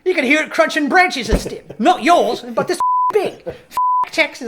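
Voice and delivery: loud, high, hammed-up Australian; bad Australian accent. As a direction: rant like an exasperated Australian, badly faked. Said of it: "my Australian accent was terrible".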